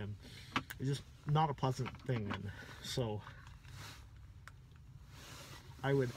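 lisping around clear retainers; speaking with mouthguard fitted; dental tray in mouth. Real, not acted. Seen 1: fitted at -12, teeth shown covered, removed by 20.6.

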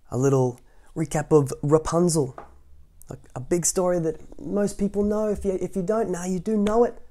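high bright enthusiasm; upbeat recap. Real, not acted. Host cheerfully recapping the story for listeners. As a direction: lift it up cheerfully.

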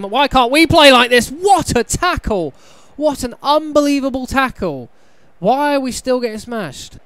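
high, loud disbelieving cries; excited outburst. Real, not acted. Gamer reacting to a tackle, loud disbelief.

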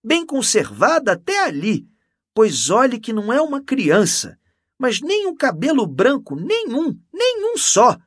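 very high, loud enthusiasm; exclaiming. A narrator's reading. Canon insisting she is well preserved, exclaiming. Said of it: "exclamou o cônego parando outra vez".

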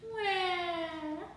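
high, loud mock cry; bawling toddler wail. A narrator's reading. Toddler bawls at her daddy, high storybook wail. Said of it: "Trixie bawled".

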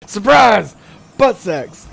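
loud surprise yell; shout. Acted. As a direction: burst out with a joke shout. Shouted game taunt.

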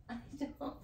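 real uneasy laugh, voice dropping away; uncomfortable laughing. Real, not acted. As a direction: laugh softly through the words, uneasy. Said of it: "Sometimes I laugh when I'm uncomfortable".

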